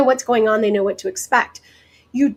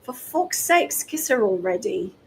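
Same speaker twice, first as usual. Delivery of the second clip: put-on British accent; 5 dB quieter than usual. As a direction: mimic an exasperated Brit, falling away at the end. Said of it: "in his very British accent".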